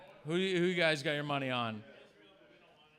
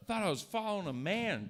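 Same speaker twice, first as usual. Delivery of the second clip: usual speaking voice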